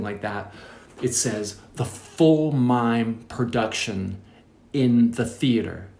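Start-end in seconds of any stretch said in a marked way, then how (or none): 1.7-5.9 slow, deliberate reading of the schedule; drawn-out read-aloud for comic weight; ominous read-out. Retold or imitated. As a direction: spell out the dreaded item slowly, word by word. Reading out alarming itinerary item.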